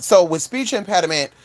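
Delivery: ordinary talk, nothing marked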